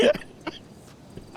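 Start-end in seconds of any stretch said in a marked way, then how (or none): 0.0-0.1 giggling through the words; breathy laugh over a short answer. Real, not acted. Laughs at being quoted.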